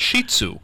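"Shih Tzu" said SHEE-tsoo; long ee in "Shih", as in she; corrected pronunciation. Shown as a demonstration. Host corrects the co-host, "Shih Tzu" said SHEE-tsoo. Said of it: "Not what you said".

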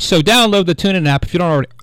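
rushed, run-together plug; talking too fast. Real, not acted. Radio host excitedly plugging an app, talking too fast. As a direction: rattle it off in a hurry. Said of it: "I'm talking too fast".

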